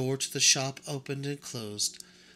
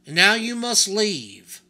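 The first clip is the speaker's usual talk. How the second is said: put-on booming, slow command to the boy; a stern old craftsman laying down the law; stern doll mender; 8 semitones higher, 12 dB louder than usual. Doll mender orders the boy to say goodbye, booming and slow.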